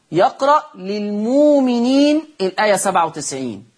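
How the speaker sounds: للمؤمنين with the hamza turned into a long واو, chanted; recited with ibdal. Shown as a demonstration. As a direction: read للمؤمنين as lilmuuminin, no glottal stop. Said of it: "بإبدال الهمز الساكن". (للمؤمنين بإبدال الهمز واوًا)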